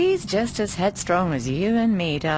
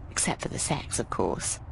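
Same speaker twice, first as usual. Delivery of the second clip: dropped, softer, half-whispered aside; lower, softer teasing aside; sly aside; 7 semitones lower, 6 dB quieter than usual. Acted. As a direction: tuck it away as a cheeky confidential aside. Sly aside about Mickey.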